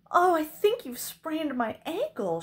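high, loud put-on complaint; declaring injury. A narrator's reading. Princess faking a sprain to the knight, loud declaration. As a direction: announce it dramatically, pitched high and loud. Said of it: "she declared".

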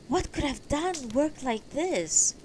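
lightly voiced man, asking slowly in dismay; wondering husband. Axel puzzled at the wound.

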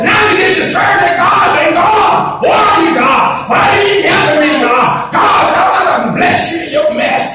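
preacher roaring to the church; full-force preaching. Acted. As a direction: hammer it home to the congregation.

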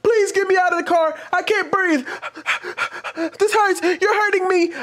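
high-pitched, hammed-up panic with gasps; over-the-top imitation of desperate pleading; dramatic mock yell. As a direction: cry out like someone faking agony. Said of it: "I'm gonna yell in y'all's ear".

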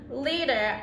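plain reading voice

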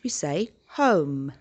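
home said slowly, silent E; slow model word. Shown as a demonstration. Teacher shows pupils how to say home, slowly. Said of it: "The E sound becomes a little bit silent".

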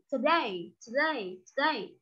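today, today, today said softly, fading; repeating today for class. Shown as a demonstration. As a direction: drill today again and again, trailing off. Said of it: "các bạn phải đọc là today".

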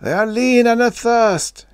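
very high, loud scream; frenzied, strained high cry; shrieking. A narrator's reading. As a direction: shriek it in terror. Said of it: "he shrieked".

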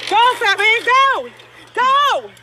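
high-pitched goading; real confrontational jeer; angry taunting. Real, not acted. Challenging him during a street quarrel.

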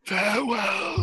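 high, eerie, drawn-out goodbye; otherworldly high parting call; Barmy. Acted. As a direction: intone a slow farewell in a high voice.

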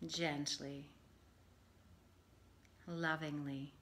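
calm, measured meditation voice with long pauses; guided meditation. Acted. Yoga teacher settling listeners for Yoga Nidra, guided meditation. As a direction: ease them gently into rest, phrase by phrase.

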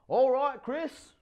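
high, loud call across the water. A narrator's reading. Father calling to his son from the water, loud and high. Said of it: "David calls out to him".

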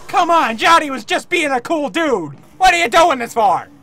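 loud, high-pitched mock outrage; indignant protest. Player scolds the attacker for killing Johnny, indignant yelling. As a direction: yell in hammed-up protest.

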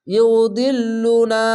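slow drawn-out tajweed chanting; Quran recitation. Shown as a demonstration. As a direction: recite it slowly, stretching the vowels. Recited word.

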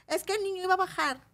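put-on high little-boy voice; little nephew. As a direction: imitate a small child explaining what happened. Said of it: "viene un sobrinito mío".

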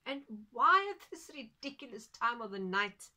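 grumpy farmer objecting; exasperated. Acted. Farmer protests the hour.

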